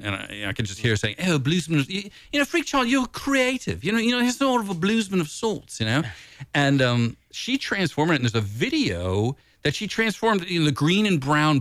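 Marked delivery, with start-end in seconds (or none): 1.2-6.0 high-pitched, lilting Welsh impression; Welsh accent. Imagining a Welsh yogi friend's reaction, in a high Welsh accent. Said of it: "she's a Welsh person".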